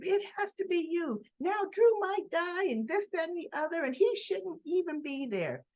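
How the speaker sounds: high-pitched mock accusation; imitating an upset accuser; accusing rant. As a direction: rattle off a worked-up complaint in a high voice. Acted accusation in retold quarrel.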